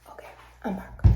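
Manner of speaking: hushed whisper, breathy and soft. Real, not acted. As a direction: whisper so nobody hears. Hiding from kids, whispering.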